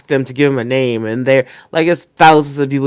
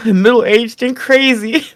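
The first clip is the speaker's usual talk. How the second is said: high, slow, through a laugh; laughing while quoting; 10 semitones higher, 4 dB louder, slower than usual. Real, not acted. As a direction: quote broken by giggles.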